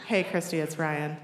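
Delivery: a hint of a subdued boy on the phone; imitating teenage boy. Boy calling the girl who likes him, lightly imitated. Retold boy's awkward phone call.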